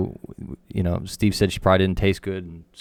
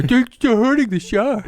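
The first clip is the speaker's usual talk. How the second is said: high, pleading outrage; mock whiny activist; 4 dB louder than usual. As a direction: whine like a protester. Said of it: "we got the activist over here".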